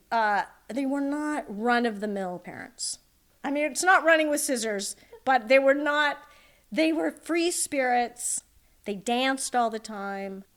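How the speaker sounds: ordinary talk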